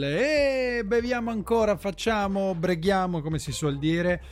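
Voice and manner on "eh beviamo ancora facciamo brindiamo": high tipsy pub cheer; a hint of a merry drunk. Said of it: "sull'onda del". (voce da ubriaco allegro al pub)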